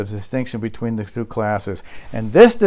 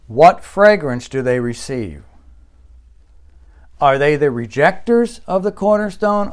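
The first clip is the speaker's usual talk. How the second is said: ordinary delivery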